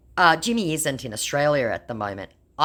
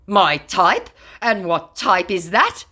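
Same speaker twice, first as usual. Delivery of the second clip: mock indignant; 5 semitones higher, 6 dB louder, slower than usual. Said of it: "beginning to get unironically indignant".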